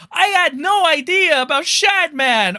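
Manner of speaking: sarcastic falsetto-ish mimicry; mocking Nux Taku. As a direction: parrot his fake innocence, high and nasal-free. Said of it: "When Nux Taku was like".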